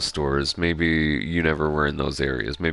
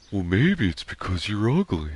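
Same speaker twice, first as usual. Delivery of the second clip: practiced second go at a dopey cartoon friend; Patrick; 5 semitones higher than usual. Streamer practicing Patrick's line, closer Patrick impression. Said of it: "Let me practice".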